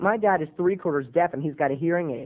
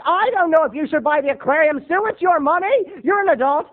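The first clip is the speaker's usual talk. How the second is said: high, louder bickering husband; dad; 11 semitones higher, 5 dB louder than usual. Retold or imitated. Husband snapping at wife over the aquarium, high loud. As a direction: bicker like the Costanzas. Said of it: "He's like".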